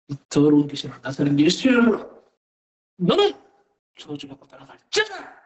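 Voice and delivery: low, commanding voice rebuking a spirit; retold exorcism command. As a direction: re-enact a firm order to an evil spirit, low until the final rise. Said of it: "그리고 명령을 했어요". (낮고 단호하게 명령하는 축사 기도)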